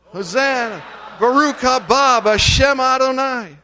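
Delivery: high, loud festive cry of praise; joyful shout. Real, not acted. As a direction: shout it out with the crowd. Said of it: "One, two, three".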